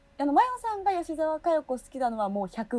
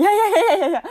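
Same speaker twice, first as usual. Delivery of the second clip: flustered laughing denial; 10 semitones higher, 11 dB louder, faster than usual. Real, not acted. Denies polite laughing, flustered.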